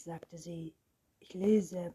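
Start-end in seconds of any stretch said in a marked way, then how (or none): none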